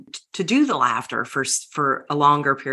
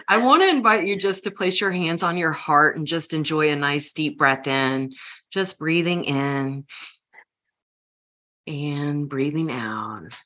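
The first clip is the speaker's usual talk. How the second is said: guided breathing. Acted. Leads a breathing exercise.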